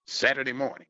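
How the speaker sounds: usual speaking voice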